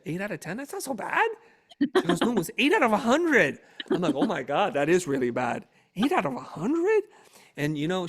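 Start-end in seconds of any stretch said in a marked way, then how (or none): 0.1-1.3 high-pitched questioning disbelief; puzzled protest. Retold or imitated. Husband asking wife about the score, puzzled protest. 2.2-3.6 loud, high, indignant retort; fuming correction. Retold or imitated. Fuming wife correcting husband on the score, indignant shout. 4.2-7.0 shocked disbelief. Retold or imitated. Husband learning the terrible score from wife, shocked disbelief.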